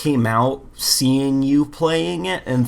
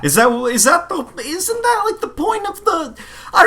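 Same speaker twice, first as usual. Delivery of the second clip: much higher, squeaky disbelief; incredulous squeak. Real, not acted. Friend questioning the game's premise, squeaky high. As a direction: climb way up in disbelief.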